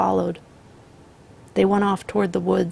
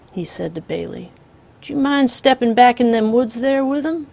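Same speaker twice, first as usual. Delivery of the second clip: calm drawling outlaw; 3 semitones higher, 8 dB louder than usual. Acted. The Misfit voiced.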